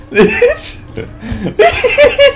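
high squeaky words through giggles; wheezy, high laughing stammer; helpless laughter. Real, not acted. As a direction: try to speak but keep cracking up. Cracking up at floating.

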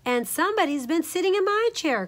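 high middle-sized bear, crying out; Mama Honey Bear. A narrator's reading. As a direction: pitch it up as an upset mother bear. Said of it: "cried Mama Honey Bear in her middle-sized voice".

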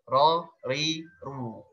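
"Ro, ri, ru" with the r trilled once; a single light tap of the tongue tip on "Ro, ri, ru"; right way after the wrong one; correct takrir. Shown as a demonstration.

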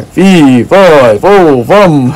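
lightly voiced fairy-tale giant chant; giant from Jack and the Beanstalk. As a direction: play at being a giant, chanting each word. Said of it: "I sound like a, a giant from Jack and the Beanstalk".

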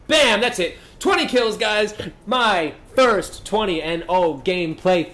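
high, loud victory celebration; triumphant cheer; excited. Real, not acted. Final twentieth kill.

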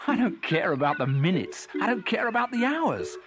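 high, exultant cuckoo voice; joyful. A narrator's reading. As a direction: proclaim each line with mounting delight. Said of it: "he sang joyfully".

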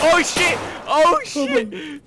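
shrieking high laughter and shouts; hysterical laughter. Real, not acted. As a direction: lose it laughing and yelling. Hysterical after kill.

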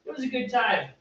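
high, gently upbeat reassurance; sarcasm-free parent. Retold or imitated. Parent humoring a happy kid, no sarcasm. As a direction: keep it sweet and high for the kid. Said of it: "got rid of all the sarcasm in there".

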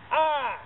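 sharp high cry of pain; pained yelp. Real, not acted. Hurting his finger on the nut, sharp pained yelp. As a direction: yelp as the sore finger gets hit. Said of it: "There goes that sore finger".

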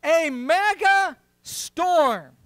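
"A mega storm" slow, with "mega" drawn out and heavy; slow weighty emphasis; stress on mega. Shown as a demonstration. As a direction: lean hard on "mega". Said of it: "Everybody say, "Mega".

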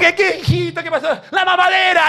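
put-on a tired mom, then the kid's demand; imitating mom and child; mock mother and child.

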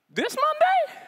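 high squeaky disbelief; shocked. Retold or imitated. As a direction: yelp it in total shock. Shocked at short notice.

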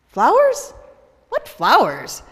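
a sharp high "Flowers?" then a blunt "What flowers?"; puzzled mail carrier. Acted. The mail carrier answers Vivian, loud and baffled.

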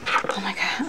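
ordinary delivery

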